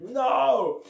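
high-pitched drawn-out cry of protest; acted protest. Punched by a fleeing girl, acted protest. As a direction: wail it in mock dismay.

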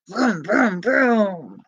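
rumbling vroom sound; car engine noise. Acted. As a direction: make a toy car sound.

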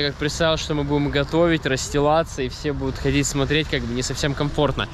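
ordinary talk, nothing marked

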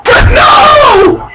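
real mounting excitement; excited fan shouting. Real, not acted. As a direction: yell the wrestler on, frantic by the end. Urging wrestler on, near-fall.

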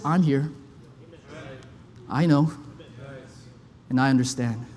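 low, soft, reassuring whisper-close; tender God voice. Acted. Preacher voices God comforting a sufferer, low and tender.

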